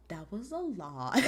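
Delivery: low, slow, unbothered; deadpan calm Damian. Retold or imitated. Damian Priest calmly answering Rhea's rambling, slow and low. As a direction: say it like a calm wall. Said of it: "he said".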